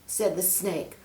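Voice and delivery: ordinary delivery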